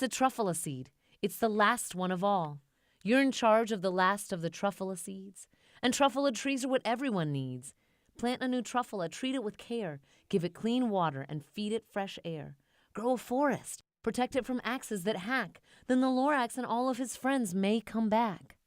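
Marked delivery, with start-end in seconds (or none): none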